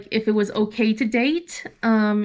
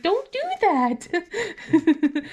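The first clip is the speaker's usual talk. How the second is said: very slow, stretched warning; firm mom; 6 semitones higher, much slower than usual. Retold or imitated. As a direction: drag it out like a mock scold. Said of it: "I was just like".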